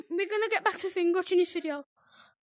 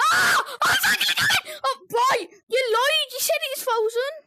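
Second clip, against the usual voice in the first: high, loud yelling in alarm; panicked shout; 6 semitones higher, 4 dB louder than usual. Real, not acted. Yelling at the friend who lied that it was frozen, panicked shout. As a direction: scream it, the thing is really coming. Monster really coming after a lie.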